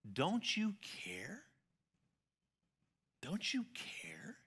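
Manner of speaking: slow, hushed pleading; frightened disciples whispering to the sleeping Jesus; desperate whisper. Acted. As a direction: whisper it like a wounded plea. Disciples' plea performed.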